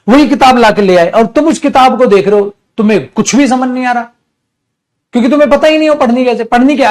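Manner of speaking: exasperated scolding. Real, not acted. Teacher scolding students who bought the wrong book, exasperated. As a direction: scold them for being so foolish.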